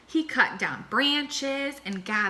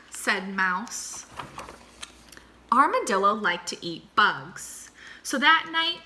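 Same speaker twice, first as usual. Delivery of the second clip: usual speaking voice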